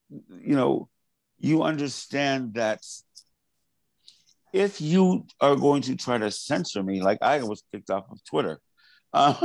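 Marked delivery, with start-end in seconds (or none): none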